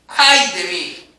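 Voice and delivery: slow, drawn-out cry of dread; trembling lament. Acted. Preacher voicing Isaiah before God's glory, slow dread-filled cry. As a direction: groan it like a man undone. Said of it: "Isaías estaba temblando".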